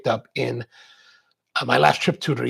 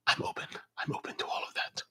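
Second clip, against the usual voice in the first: whisper; 15 dB quieter, faster than usual. Real, not acted. Hushed aside after George's offer.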